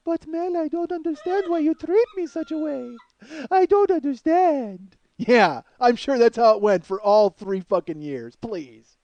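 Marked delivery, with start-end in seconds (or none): 0.1-5.0 Russian mail-order bride. Acted. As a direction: play the wounded, innocent Russian bride. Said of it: "Russian mail order bride voice".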